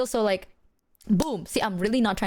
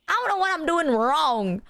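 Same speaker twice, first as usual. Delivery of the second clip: mock wailing lament; 5 semitones higher than usual. Streamer protesting they do nothing wrong, mock wail. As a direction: whine it out in mock despair. Comic lament about being misunderstood.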